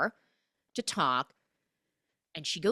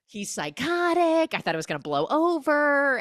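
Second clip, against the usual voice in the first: high, loud mock distress; mock panic; 10 semitones higher, 6 dB louder than usual. Mother confessing to her friend, loud mock panic. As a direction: blurt it out in panic.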